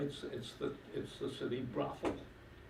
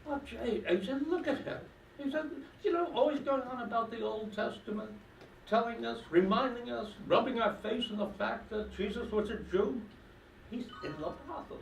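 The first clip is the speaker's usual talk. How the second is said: put-on high, loud indignant old man; scandalised old fella; 8 semitones higher, 4 dB louder than usual. Pensioner in the pub complaining about the rabbi, high and indignant. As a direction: rant like a nosy pensioner.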